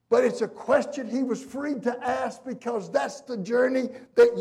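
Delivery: loud, high, driving sermon delivery; full-force preaching. Real, not acted. Preacher pressing the congregation about questioning God, full-force preaching.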